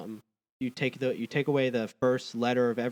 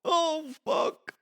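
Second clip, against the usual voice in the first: flustered laughing; 6 dB louder, much slower than usual. Real, not acted. Flustered after word stumble.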